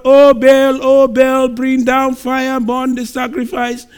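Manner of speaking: loud, high pleading cry to Baal. Acted. Priests crying out to Baal for fire, a loud high wail. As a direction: wail it up to the heavens. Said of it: "they cried to their God".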